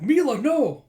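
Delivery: high, loud pleading cry; desperate shout. Acted. Companion trying to stop Mila, desperate scream. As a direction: yell to stop her.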